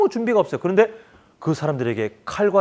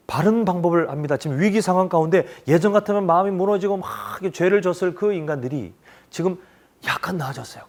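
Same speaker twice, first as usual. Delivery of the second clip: ordinary talk, nothing marked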